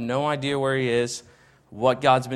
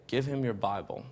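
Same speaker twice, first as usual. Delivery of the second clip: hushed, much softer inner voice; a hint of a still small voice from God; 3 semitones lower, 8 dB quieter than usual. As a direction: speak it gently like a quiet voice in your head. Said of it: "I hear this small, still voice say".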